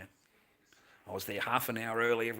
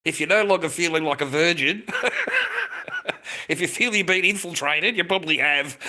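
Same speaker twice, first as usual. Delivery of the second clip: loud, high, real laughing fits; 7 semitones higher, 8 dB louder than usual. Real, not acted. Teasing someone about the morning after, laughing hard. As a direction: crack up at your own joke. Cracks up at own crude joke.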